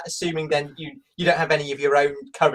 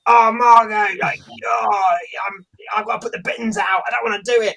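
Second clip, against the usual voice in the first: higher-pitched exasperated moaning; hammed-up domestic bickering; mock annoyed; 4 semitones higher, 4 dB louder than usual. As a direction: play a fed-up partner grumbling. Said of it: "we'd be angry at each other".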